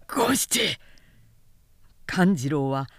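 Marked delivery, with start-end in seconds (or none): 0.1-0.8 mild called-out name; calling a name. Acted. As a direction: call to his henchman mid-struggle. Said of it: "ながら叫んだ".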